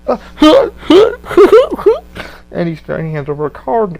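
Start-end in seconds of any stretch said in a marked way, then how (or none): 0.2-2.5 high, loud cackling laugh fit; imitated maniac cackle; manic laugh. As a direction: burst into the uncontrollable Joker laugh.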